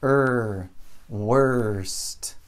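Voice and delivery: held "Er", then "worst" with that same R as vowel; same R sound. Shown as a demonstration. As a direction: draw out the R in "worst". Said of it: "a pronúncia é a mesma coisa".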